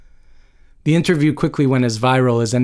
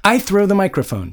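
high-pitched, exasperated declaration; contained narrated yell; 8 semitones higher than usual. A narrator's reading. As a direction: announce it in angry indignation. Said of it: "she yelled".

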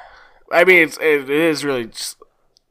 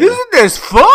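high, loud goofy shout; hammed-up partier whooping it up; mock party excitement; 10 dB louder than usual. Mocking the gorilla-suit partygoer, over-excited shout.

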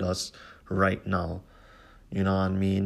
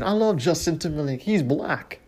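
imitating [name]; 7 semitones higher than usual.

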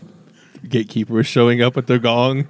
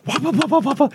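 mimicked horn noise; 11 semitones higher than usual. Mimicking the music.

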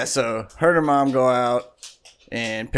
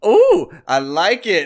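louder, drawn-out pleased ooh; delighted; 7 semitones higher, 8 dB louder than usual. Retold or imitated. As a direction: savour it like seeing the new color. Daughter admiring her dyed hair.

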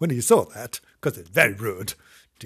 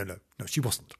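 plain reading voice

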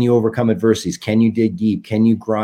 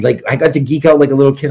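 ordinary delivery

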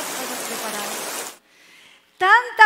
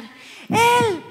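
raised, high acted shout; 6 semitones higher, 8 dB louder, slower than usual. Husband telling his wife who saved her, shouting. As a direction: cry it out like an amazed witness. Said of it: "empieza a gritar".

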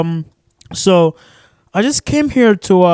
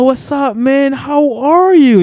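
mock cheerful bro greeting, pitched up; friendly greeting; 7 semitones higher, 3 dB louder, slower than usual. Imitating a friend greeting him warmly. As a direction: play a buddy calling out a hearty hello. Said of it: "It's not like somebody kinda like".

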